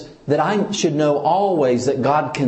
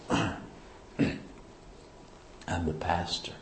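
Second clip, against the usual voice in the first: near-whisper, much softer and lower; self-important hushed claim; 7 semitones lower, 15 dB quieter than usual. Acted. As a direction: drop to a low, hushed aside, imitating self-importance. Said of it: "I may not say it like that".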